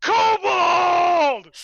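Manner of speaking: long, high bellow falling at the end; huge yell. Acted. Fighter spots something and yells to the others, huge shout. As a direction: yell it out with everything, drawn out. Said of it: "and he yells".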